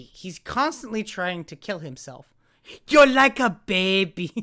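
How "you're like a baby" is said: Avatar quote. Retold or imitated. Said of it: "to quote Avatar".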